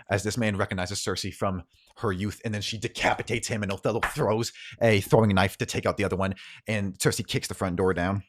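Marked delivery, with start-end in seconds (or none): none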